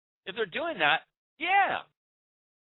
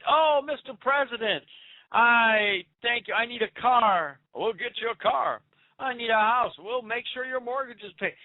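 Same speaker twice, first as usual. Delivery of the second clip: mocking grateful supporter; 6 semitones higher than usual. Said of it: "women stood up and said".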